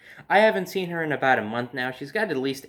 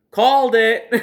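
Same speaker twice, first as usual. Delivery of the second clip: loud high gloating call; half-laughing I-told-you-so shout; triumphant called it; 9 semitones higher, 9 dB louder than usual. Retold or imitated. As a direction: crow it like you knew all along. Smug exclamation when alarm goes off.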